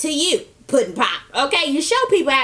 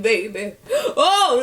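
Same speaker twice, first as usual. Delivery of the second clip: breaking into laughter; laughing; much slower than usual. Real, not acted. Recapper cracking up while voicing grandma. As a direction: laugh while still comforting.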